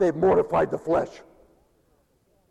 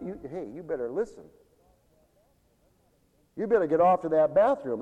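ordinary talk, nothing marked

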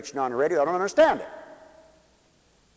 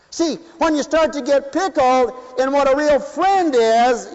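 preaching; 5 semitones higher, 3 dB louder than usual. Acted. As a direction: drive it home to the congregation. Preacher's rising list of sins.

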